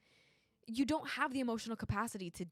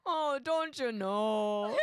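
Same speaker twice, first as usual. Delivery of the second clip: put-on drawn-out, giggly Minnesotan accent; slow, laughing stab at Minnesotan; 4 dB louder, much slower than usual. Game player attempting the accent just called out, Minnesotan accent.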